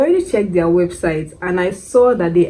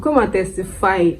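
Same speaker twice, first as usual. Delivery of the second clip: ordinary talk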